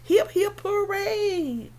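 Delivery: high-pitched hip-hip, drawn-out falling hooray; cheering hippo. Acted. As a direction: cheer as a jolly cartoon animal.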